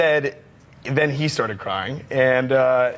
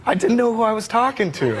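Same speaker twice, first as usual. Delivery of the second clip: [name] impression; 8 semitones higher, slower than usual. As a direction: lift the pitch and keep it gentle and breathy-free, like Michael. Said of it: "And he goes".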